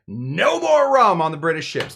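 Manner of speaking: announcing a ban to everyone; mock proclamation. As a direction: proclaim it loudly like an official ruling. Acting out the debate's verdict.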